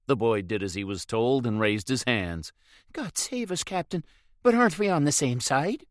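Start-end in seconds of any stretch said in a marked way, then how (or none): 2.9-5.9 high, pleading Irish lad; a frightened young lad begging, pitched high; scared boy. A narrator's reading. Caught boy pleads with the captain, high and anxious.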